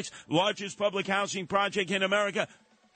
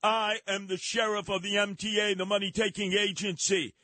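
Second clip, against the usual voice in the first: tough-guy subway announcement; 5 semitones higher than usual. Acted. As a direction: bark it like a self-made transit sheriff. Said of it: "And this is the announcement".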